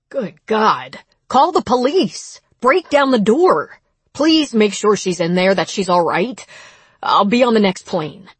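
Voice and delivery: alarmed urgency. A narrator's reading. Rob begging neighbor to check on Sydney, alarmed. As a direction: plead and order in rising alarm.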